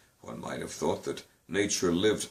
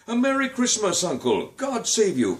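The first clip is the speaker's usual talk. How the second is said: high bright call of greeting, wistful edge; wistful cheerful cry; 13 semitones higher, 5 dB louder than usual. A narrator's reading. Nephew greets his uncle with a wistful, high, loud cry. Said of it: "cried a wistful, cheerful voice".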